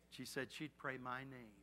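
usual speaking voice

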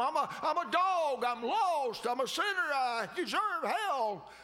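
high, loud, pleading cry to the Lord; fervent pleading prayer. Acted. Preacher voicing a sinner begging God for mercy, high and loud.

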